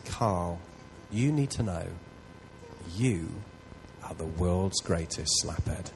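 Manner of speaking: low, slow and gentle, each word set apart; God's gentle voice. Retold or imitated. God speaking tenderly to Carl, low and slow. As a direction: speak softly as God comforting.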